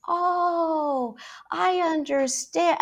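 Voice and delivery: high, slow sugary talking down; condescending tone. Retold or imitated. TikTok parent talking down to a kid, high condescending coo. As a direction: talk down to a child in a syrupy voice. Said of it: "such a condescending tone with the kid".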